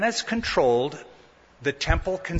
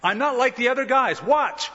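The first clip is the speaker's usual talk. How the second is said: boastful bravado; 9 semitones higher than usual. Retold or imitated. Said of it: "To prove that".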